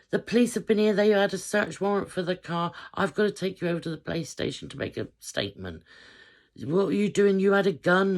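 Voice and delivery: dad telling daughter the police came, frantic; imitating alarmed dad. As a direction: spell out the trouble she's in, frantic. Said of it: "dad explaining, shouting".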